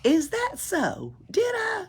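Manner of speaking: high, slow feigned surprise; mock surprise. Pippi pretending she forgot, high mock surprise. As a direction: play innocent.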